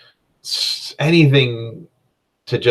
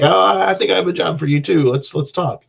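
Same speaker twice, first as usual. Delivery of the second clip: flamboyant rogue; 8 semitones higher than usual. Retold or imitated.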